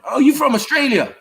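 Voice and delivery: high-pitched mock amazed question; mimicked curious American. American stranger guessing the Londoner's origin, mock amazed.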